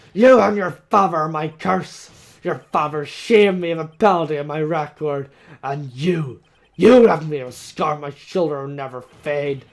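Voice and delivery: loud, seething threats; furious tirade. Acted. Von Karma vowing revenge on Edgeworth, loud fury. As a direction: spit each threat with hatred. Villain's enraged threat speech.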